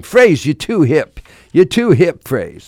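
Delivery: a hammed-up Boston drawl on hip; Boston accent. Radio guest doing his Boston friend's accent. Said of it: "That's my Boston accent".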